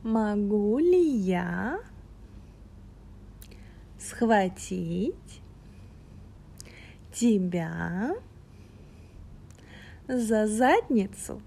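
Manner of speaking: "Могу ли я схватить тебя за задницу" broken into slow pieces with pauses; feed it word by word. Shown as a demonstration. Guiding the listener word by word, slow pauses. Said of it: "Just repeat after me".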